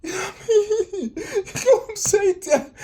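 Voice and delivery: high laughing voice, words pushed through the laugh; giggling question between laughs. Real, not acted. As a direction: laugh your way through it, real amusement. Laughing at own mock impression.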